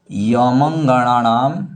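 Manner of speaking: slow, connected reading of यमंगणानाम; measured यमंगणानाम with the vowels held; sutra recitation. Shown as a demonstration. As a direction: recite यमंगणानाम slowly as one word. Teacher first reads the sutra.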